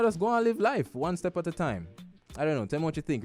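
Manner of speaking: normal talking voice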